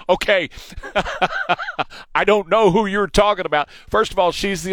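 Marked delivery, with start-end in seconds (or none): 0.5-1.8 a long high real laugh; high laughter. Real, not acted. Host mocking her twang, high laughter. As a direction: laugh at how she sounds.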